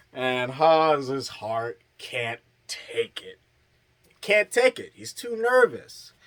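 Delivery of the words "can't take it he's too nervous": high, jittery mock panic; nervous squeak. Recapper voicing Hawes losing his nerve, mock nervous squeak. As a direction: squeak it out like a scared friend.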